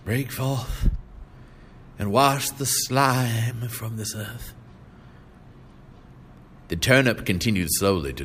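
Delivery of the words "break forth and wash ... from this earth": slow, solemn prayer to the Lord; a touch of revival-preacher prayer. A narrator's reading. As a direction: plead with heaven slowly. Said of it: "he prayed".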